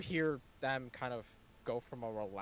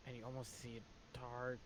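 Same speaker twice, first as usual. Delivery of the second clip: normal talking voice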